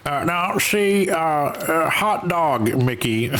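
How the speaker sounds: another Jimmy Stewart try. Retold or imitated. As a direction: hesitate and drawl out the words like a classic actor. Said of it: "I can't do Jimmy Stewart".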